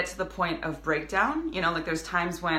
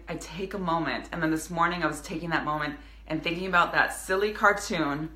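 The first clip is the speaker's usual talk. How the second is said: normal talking voice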